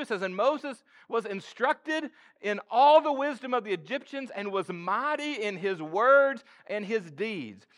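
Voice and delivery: high, loud sermon delivery; fired-up preacher; full-force preaching. Acted. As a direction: drive the point home to the congregation.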